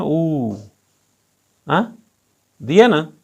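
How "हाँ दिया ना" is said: ordinary talk, nothing marked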